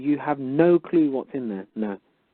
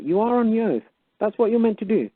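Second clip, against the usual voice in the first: high, outraged reminder; indignant lecture; 10 semitones higher than usual. Retold or imitated. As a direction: scold the official, pitched up high. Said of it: "You write back and you say".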